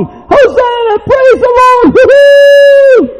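very high, loud jubilant whooping; cheering crowd member. Acted.

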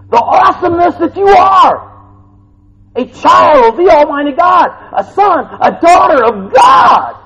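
high, loud, shouting sermon; rousing preaching. Acted. Preacher proclaiming God's power, roaring full-force preaching. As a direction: build to a triumphant roar.

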